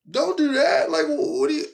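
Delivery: recoiling from something spooky he doesn't want to hear; freaked-out protest. Retold or imitated.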